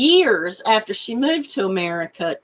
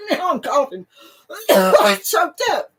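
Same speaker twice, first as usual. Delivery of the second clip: ordinary talk, nothing marked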